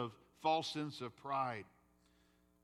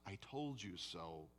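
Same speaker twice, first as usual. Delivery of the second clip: 1 tender reassurance; 7 semitones lower, 10 dB quieter than usual. Retold or imitated. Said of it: "So when Jesus says".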